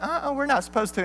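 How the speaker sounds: put-on high, quick, soft girly protest; Nala. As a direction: imitate a little girlfriend objecting in falsetto. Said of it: "And she's like".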